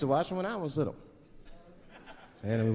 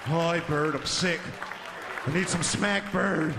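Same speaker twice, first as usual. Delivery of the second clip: Snuffy voice; slower than usual. Retold or imitated. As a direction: slur it like a junkie elephant.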